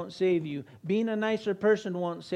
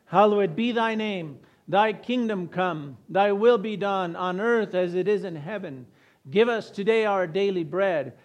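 louder, measured communal prayer; congregational prayer recitation; 5 dB louder than usual. Real, not acted. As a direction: lead the room in reciting, phrase by phrase. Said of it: "Let's say it together".

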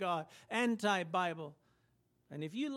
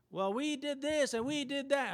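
higher, whiny excuse-maker voice; pleading excuse; 6 semitones higher than usual. Acted. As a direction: plead your case like someone turned away. The rejected pleading at the door.

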